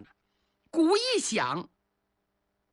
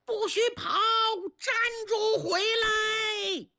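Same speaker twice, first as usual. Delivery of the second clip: much higher, urgent commanding shout; shouting to stop them; 13 semitones higher than usual. Acted. Chiyou yelling at his fleeing soldiers, much higher shout. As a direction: yell after fleeing soldiers. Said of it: "蚩尤急忙大声制止".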